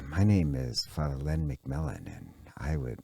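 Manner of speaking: very low, soft radio murmur; parodied NPR voice. Acted. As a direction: introduce yourself in a hushed mock public-radio voice, lingering on soft mms and ohs. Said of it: "she speaks like this".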